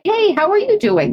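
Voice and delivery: very high, chirpy hello; high cheery greeting. Retold or imitated. Greeting a returning chatter, very high and chirpy. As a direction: sing out a bubbly hello.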